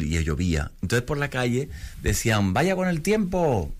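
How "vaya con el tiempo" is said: mocking passer-by. People in street mock weatherman.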